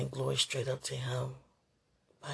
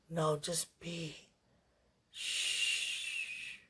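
soothing; 4 dB quieter, much slower than usual. Real, not acted.

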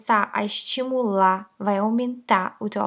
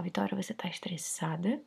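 whispering; 3 semitones lower, 10 dB quieter than usual. Shown as a demonstration. Self-talk demo in whisper.

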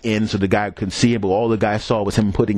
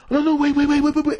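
panicked pleading. Retold or imitated. Guy pleads as she sees.

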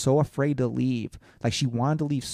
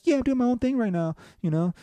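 much higher imitated girl voice; girl's casual reply; 11 semitones higher than usual. Host quotes the girl's answer, high mock girl voice. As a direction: imitate her in a raised pitch.

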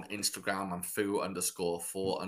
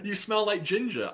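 much higher mock pick-up line; playful imitation of her; 11 semitones higher than usual. Playing Megan Fox approaching him, high playful imitation.

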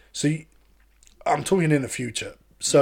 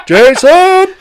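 "Jason" yelled much higher and louder, drawn out; shouted name; 16 dB louder than usual. Acted. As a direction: belt out "Jason" like calling across a room.

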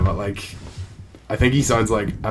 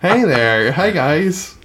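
higher, louder goofy hero greeting; dorky Spider-Man voice; 7 semitones higher, 5 dB louder, much slower than usual. Retold or imitated. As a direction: play a dorky superhero saying hi. Mocking Spider-Man sitting like dink.

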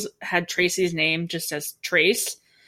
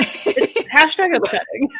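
laughing; 12 semitones higher, 5 dB louder, slower than usual. Real, not acted. Mocking echo, laughing.

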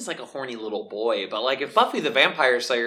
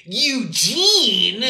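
mocking call; 10 semitones higher, 6 dB louder than usual. Kids mocking a weird name.

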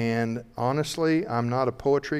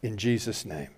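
closing prayer; 4 dB quieter than usual. Real, not acted. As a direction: bless them quietly in prayer.

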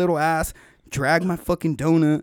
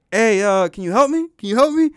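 calling out louder and higher for help; 5 semitones higher, 7 dB louder than usual. Retold or imitated. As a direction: shout across the water so they finally hear you. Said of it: "I don't think they heard me".

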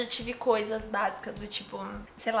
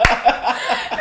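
loud laugh; 7 dB louder than usual. Real, not acted. Laugh after drunken shout.

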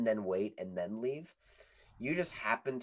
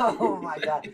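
laughing; 6 semitones higher, 5 dB louder than usual. Real, not acted. Laughing reaction.

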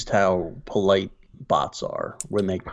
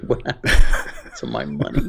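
laughing mock shock; 5 semitones higher, 4 dB louder than usual.

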